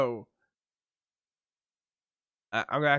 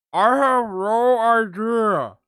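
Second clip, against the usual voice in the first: slow, louder, slurred as if through a numb mouth; imitating a numbed, gauzed-up mouth; 9 semitones higher, 10 dB louder, much slower than usual. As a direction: mumble it like your mouth is full of gauze. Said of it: "this voice you're doing for you with this numbed up, gauzed up mouth".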